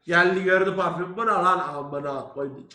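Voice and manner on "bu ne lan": louder gruff father demand; angry father bark. Retold or imitated. Father finding the perfume in his son's room, loud demand. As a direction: bark it like a furious dad.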